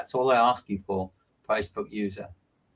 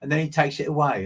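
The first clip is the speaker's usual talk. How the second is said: usual speaking voice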